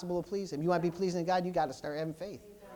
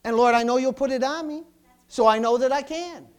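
pleading prayer; 3 semitones higher, 7 dB louder than usual. Pretend. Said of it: "You say".

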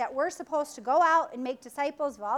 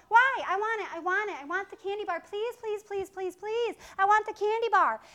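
put-on whining child; 6 semitones higher than usual. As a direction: beg like a spoiled kid at the checkout. Kid begging in grocery store.

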